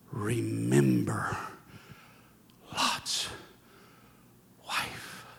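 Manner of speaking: slow, low, whispered warning; solemn whisper. Acted. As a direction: hush the room with it.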